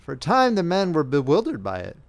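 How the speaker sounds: ordinary delivery, nothing marked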